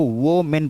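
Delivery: वो मेन in two parts, "wo-men"; spelling pronunciation. Shown as a demonstration. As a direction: split it as वो मेन with an O. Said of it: "इसमें मेन लिखा हुआ है".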